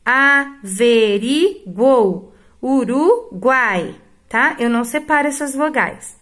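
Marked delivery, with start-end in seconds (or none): 0.0-3.9 averiguou and Uruguai stretched slowly; slow example words. Shown as a demonstration. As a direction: model each word slowly for pupils. (palavras ditas devagar)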